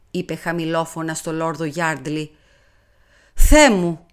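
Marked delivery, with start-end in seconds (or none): 3.3-4.0 a loud, startled cry of alarm; alarmed exclamation. Acted. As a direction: blurt it out in sudden fright. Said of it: "αναφώνησε αλαφιασμένα ο ευγενής". (τρομαγμένο, δυνατό επιφώνημα)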